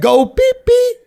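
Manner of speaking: put-on high sing-song baby talk to a puppy. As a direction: coax the puppy like a toddler. Talking to puppy on walk.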